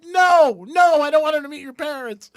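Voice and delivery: hammed-up horrified teen; panicked refusal. Son refusing mom meeting the parents, frantic shout. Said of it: "I'm like".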